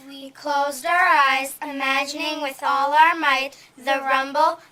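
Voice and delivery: booming storytelling; loud excited narration. A narrator's reading. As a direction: narrate loudly with awe. Imagining rocket force.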